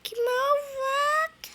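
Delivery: slow, high drawn-out reproach; mock outrage. Real, not acted. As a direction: stretch it out, playfully hurt. Teasing the friend.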